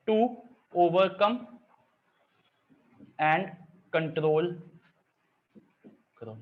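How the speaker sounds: each word spaced out slowly with long pauses; slow mnemonic dictation. Shown as a demonstration. Teacher slowly reading out the corona mnemonic to students, spaced and deliberate. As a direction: spell it out word by word for the class. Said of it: "देखो, बारह point है ना".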